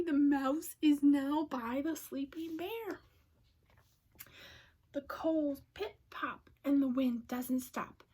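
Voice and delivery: plain reading voice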